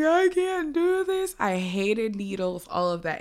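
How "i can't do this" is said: high, slow, strained plea, close to tears; reliving the pain of stitches, high desperate plea; panicked whine. Retold or imitated. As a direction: beg like a scared teen getting stitches.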